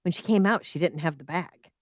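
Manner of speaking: ordinary talk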